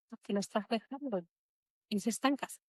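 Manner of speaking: very soft inner voice; quiet, hushed voice in her head. Retold or imitated. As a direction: let it drop to a hush, like a voice inside. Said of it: "esa voz".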